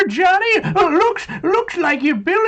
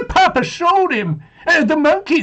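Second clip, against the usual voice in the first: ordinary talk, nothing marked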